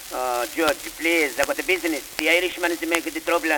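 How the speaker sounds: broad stage Italian fruit vendor pleading; pleading with the judge in a thick Italian accent. Acted. Fruit vendor pleading his case to the judge, Italian accent.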